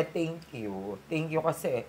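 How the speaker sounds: ordinary talk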